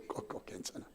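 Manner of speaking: unvoiced, whispered reassurance; whispered aside. Real, not acted. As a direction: whisper it kindly to the flustered listener. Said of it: "끌려고 하는 분 있죠". (휴대폰 끄려는 교인에게 속삭이듯)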